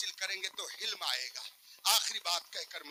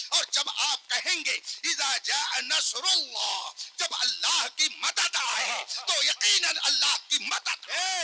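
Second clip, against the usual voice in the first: rising higher and louder to a full shout; building to shout; 8 semitones higher, 7 dB louder than usual. Real, not acted. Preacher rouses the crowd, building to a shout. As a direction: build the fervour until you cry out. Sermon climbing to a climax.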